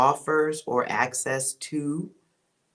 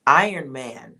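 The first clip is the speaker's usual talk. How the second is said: loud; 7 dB louder than usual. Real, not acted. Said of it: "repeated name, first take loud".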